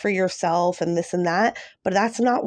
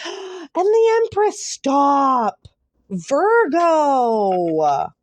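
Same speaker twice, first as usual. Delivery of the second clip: gasp, then much higher and louder in disbelief; overjoyed gasp; 7 semitones higher, 4 dB louder, much slower than usual. Real, not acted. Reader finding the Empress for Virgo, gasping loudly in joy. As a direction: gasp and exclaim like you can't believe it. Second big card for Virgo.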